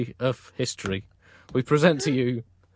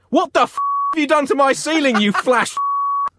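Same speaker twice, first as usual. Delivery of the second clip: high-pitched, loud comic fury; acted apoplectic yell; outraged shouting; 12 semitones higher, 5 dB louder than usual. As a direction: explode in hammed-up outrage. Angry Pope sees the ceiling.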